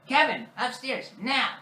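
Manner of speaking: high-pitched mock command; stern parent impression. Parent insisting Kevin go upstairs, mock stern command. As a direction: order it firmly as a caricature parent.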